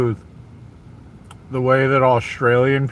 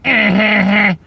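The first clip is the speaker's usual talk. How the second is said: higher-pitched jeering cackle, taunting; higher mock laugh sneering at an accent; mocking laughter; 7 semitones higher than usual. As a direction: laugh at them like a schoolyard bully, higher up. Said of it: "you did something other than".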